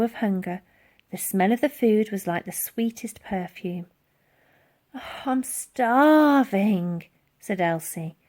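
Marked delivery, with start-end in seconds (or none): 4.9-7.0 slow, drawn-out hungry plea; hungry orphan eyeing the jam; pleading girl. Acted. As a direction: sigh it out, longing.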